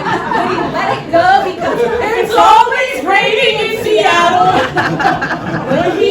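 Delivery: high, loud, laughing rant; loud and incredulous; loud comic rant. Real, not acted. Comic rant about Seattle rain.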